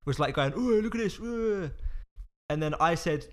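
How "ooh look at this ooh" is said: high-pitched teasing imitation of a lad; mock oohing. Imitating the big guy fooling around.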